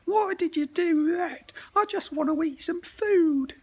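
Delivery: very high, loud whiny crying goblin; wailing goblin. Acted. Goblin whines at Bubble Monster after losing its hand, crying loudly. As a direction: wail like a hurt, sulky monster. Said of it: "said the goblin".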